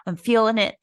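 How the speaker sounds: mock pumped up. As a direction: hype yourself up, a bit fast. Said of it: "I'm like".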